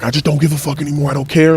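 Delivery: high, fraying retold outburst; overwhelmed. Telling best friend he has given up, overwhelmed. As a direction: reenact being overwhelmed, pitch pushed up. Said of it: "I remember I was overwhelmed".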